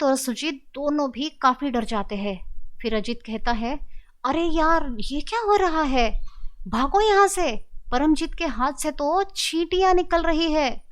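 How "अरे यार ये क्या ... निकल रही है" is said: alarmed. A narrator's reading. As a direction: shout at them to run. Friend sees ants from wound. (डर के मारे ऊँची आवाज़ में चिल्लाना)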